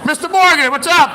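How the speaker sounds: loud, high greeting across the street; friendly loud call to a passing neighbor. Real, not acted. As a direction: hail a neighbor from a distance.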